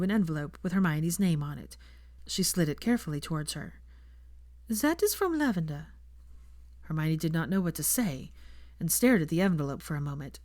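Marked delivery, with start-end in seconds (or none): 4.7-5.9 Fleur. A narrator's reading. Fleur asks Draco for time with Hermione, polite and higher.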